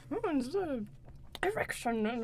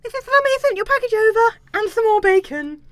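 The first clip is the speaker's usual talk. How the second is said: servant voice; 8 semitones higher, 9 dB louder than usual. Acted. Servant character voice.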